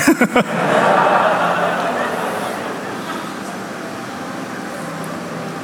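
soft unvoiced laugh; breathy laugh. Real, not acted. Preacher amused by his own story, a soft breathless laugh. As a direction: laugh under your breath.

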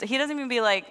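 ordinary talk, nothing marked

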